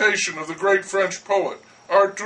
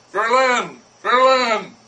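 loud, higher calls through the door; calling shout; 4 semitones higher, 4 dB louder, slower than usual. Acted. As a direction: pound on the door and yell the name. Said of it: "pounding on it and shouting".

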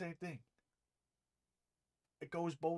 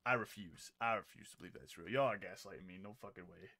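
low stunned disbelief; 9 semitones lower, 6 dB quieter, faster than usual. Real, not acted. Disbelief after the clip.

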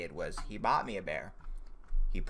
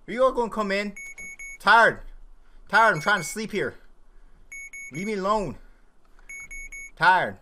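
high, loud, falling wails; whiny protest; 10 semitones higher, 7 dB louder than usual. Pretend. Player telling the bears to let them sleep, whiny shouting. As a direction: cry out like someone kept from sleep.

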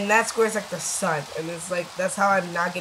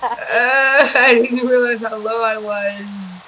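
laughing; 4 semitones higher, 8 dB louder, much slower than usual. Real, not acted. Laughing after being shot.

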